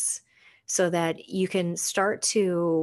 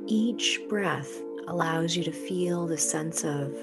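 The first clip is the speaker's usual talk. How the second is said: soft spa whisper; 5 dB quieter than usual. Acted. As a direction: ease them into calm, unhurried. Leads a guided meditation.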